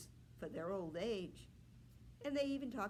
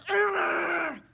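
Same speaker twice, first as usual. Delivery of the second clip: loud hammed-up growl; a princess growling at the king; angry growl; 8 semitones higher, 15 dB louder than usual. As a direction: growl like a cartoon grump. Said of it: "with a terrible temper".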